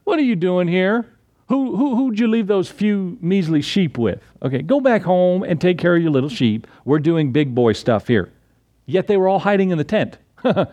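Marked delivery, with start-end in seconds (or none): 0.0-8.3 higher-pitched belittling taunt, acted out; mock a little brother who has turned up uninvited; scornful older brother. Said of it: "what's his brothers say".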